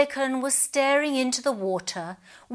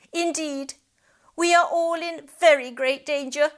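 higher, grave proclamation; proclaiming pelican; 5 semitones higher, 3 dB louder than usual. A narrator's reading. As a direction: announce doom like a prophet. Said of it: "proclaimed the pelican".

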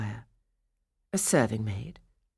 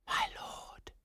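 breathy, unvoiced whisper; 12 dB quieter than usual. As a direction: whisper it, as if afraid to wake anyone. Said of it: "she whispered".

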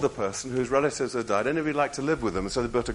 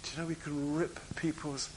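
hushed; 2 semitones higher, 10 dB quieter than usual. Real, not acted. Preacher's hushed appeal.

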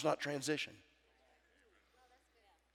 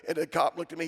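usual speaking voice

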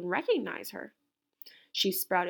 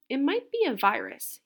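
raised, firm expert pronouncement; with authority; 5 semitones higher, 5 dB louder than usual. A narrator's reading. Doctor Gourd diagnosing Camilla, said with authority. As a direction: declare it like the expert in the room. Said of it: "he said with authority".

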